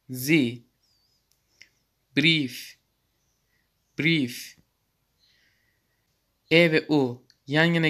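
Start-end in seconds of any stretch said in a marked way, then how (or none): 2.2-4.6 brief said breef with a long ee; German pronunciation. Shown as a demonstration. Teacher modelling the ie sound for students. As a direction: stretch the ee in brief. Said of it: "diye okunmaktadır".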